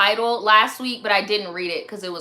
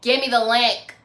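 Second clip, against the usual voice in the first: put-on kid demand, doled out in pieces; little demanding boy voice. Host imitating pushy viewers in her DMs, demanding kid voice. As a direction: insist like a blunt little boy. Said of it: "with that voice".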